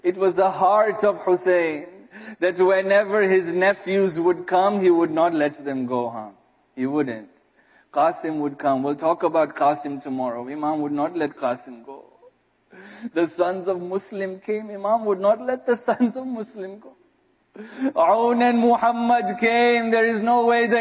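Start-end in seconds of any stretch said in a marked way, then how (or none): none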